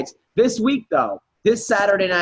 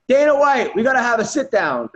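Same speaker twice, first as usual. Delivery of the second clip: high, raised imitation of a fighter's speech; higher-pitched retold demand; mock call-out; 6 semitones higher than usual. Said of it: "in his speech, Don, he goes".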